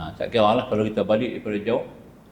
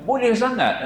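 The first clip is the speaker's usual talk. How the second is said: much higher, bright exclaimed answer; mock surprised reply; 12 semitones higher, 4 dB louder, slower than usual. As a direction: tease the caller with a high exclaim. Ustaz answering caller on phone. (jawapan ustaz kepada pemanggil, terkejut dan bernada tinggi)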